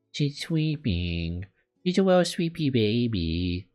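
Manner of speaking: drawn-out, doting coo. Real, not acted. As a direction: coo over a sleepy little one. Cooing over sleeping game character.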